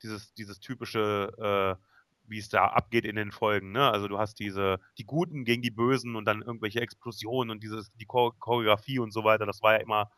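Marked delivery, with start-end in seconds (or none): none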